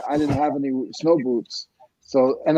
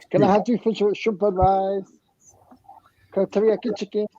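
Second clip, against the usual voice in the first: mock Chinese accent; 6 semitones higher than usual. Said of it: "I talk with Chinese when I go to get Chinese food".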